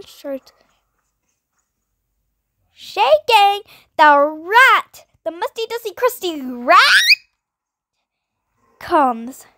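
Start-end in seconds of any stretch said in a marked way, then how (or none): 2.7-9.5 loud, high dramatic announcing; excited buildup. Acted. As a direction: hype up the monster coming. Hyping the rat's arrival.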